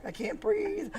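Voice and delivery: high, squeezed out through laughter; helpless laughing squeak; laughing complaint. Real, not acted. Laughs while listing ailments.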